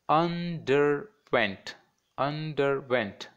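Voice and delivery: "underwent" slowly split as un-der-went; three spaced beats on "underwent"; three separate syllables. Shown as a demonstration. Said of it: "that is three syllables".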